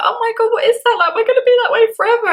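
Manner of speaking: high, loud panicked squeal; mock panic. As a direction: replay the panic, voice shooting up. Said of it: "I was like".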